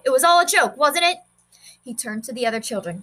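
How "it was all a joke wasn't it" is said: mocking boy. Boy taunts the class.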